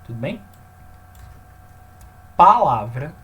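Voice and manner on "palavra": "Palavra" called out much louder, with a rising tune; emphatic term. Shown as a demonstration. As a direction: announce the term "Palavra" with a loud rise. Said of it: "teacher introduces a new term".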